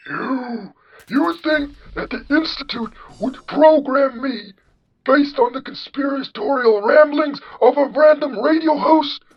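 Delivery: put-on very high, loud old-man caricature; John Henry. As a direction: draw it out in a shrill, indignant old-man falsetto. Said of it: "you have the generic old man voice".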